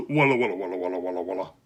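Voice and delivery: crowd murmur. Acted.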